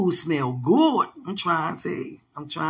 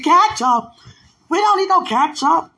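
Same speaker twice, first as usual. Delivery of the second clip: high, loud, imitating an affronted host; comically outraged retold refusal; mock indignation; 12 semitones higher, 7 dB louder than usual. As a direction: act out offended disbelief, voice way up. Acting out offended hosts' reaction.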